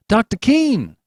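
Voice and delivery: high, loud call across the street; calling out after the doctor. Acted. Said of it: "he called".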